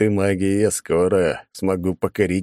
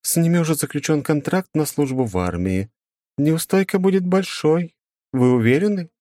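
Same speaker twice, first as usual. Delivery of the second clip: voiced woman, higher pitch; a concerned school official; woman's voice; 10 semitones higher than usual. Female character, held higher voice.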